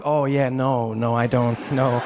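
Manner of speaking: low-pitched, uneasy backing out; reluctant refusal. Retold or imitated. Tourist opting out of snakes, low reluctant refusal. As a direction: decline politely but firmly, pitched low. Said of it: "And so I'm like".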